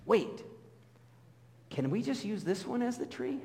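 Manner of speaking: high, eager wait; asking the city crew to keep the tree, eager; hopeful plea. Retold or imitated. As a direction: pitch up and plead for the old tree. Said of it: "I say to them".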